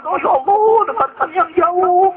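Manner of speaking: exaggerated whiny prayer for show; mock pleading prayer. Shown as a demonstration. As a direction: plead to the sky like a show, slowly.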